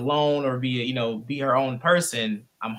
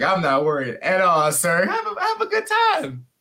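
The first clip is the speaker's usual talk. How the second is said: carefree dad; 6 semitones higher, 4 dB louder than usual. Retold or imitated.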